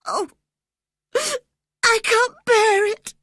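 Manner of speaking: high, sobbing plea; a frightened boy sobbing it out; high tearful cry of a terrified boy. A narrator's reading. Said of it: "he sobbed".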